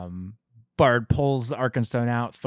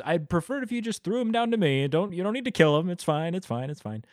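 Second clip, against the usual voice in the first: rushes to calm Thorin, trailing off on It's fine; mock Gandalf; 7 semitones higher, faster than usual. Podcaster mocks Gandalf talking Thorin down, high and fast, fading. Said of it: "and says".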